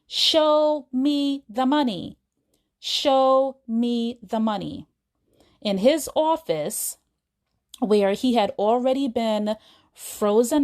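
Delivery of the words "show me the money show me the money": slow, drawn-out mock holler; mild mock scream. Agent proving his loyalty to client, mild mock scream. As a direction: stretch each word as an imitated rallying cry. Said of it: "scream at the top of his lungs".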